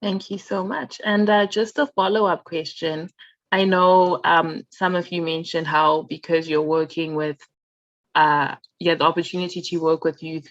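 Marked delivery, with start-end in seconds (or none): none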